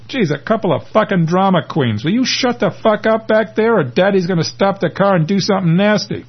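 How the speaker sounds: high, sing-song mock-parent threat; exasperated scolding. Driver to two bickering passengers, mockingly exasperated. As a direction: scold them like squabbling kids in the back seat.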